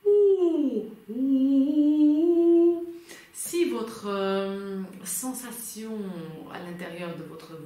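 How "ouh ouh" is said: high, loud hooted "ooh"; owl-like rounded hoot; round, open "ooh". Shown as a demonstration. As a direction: demonstrate a full, rounded "ooh" on a held high note. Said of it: "c'est un son rond".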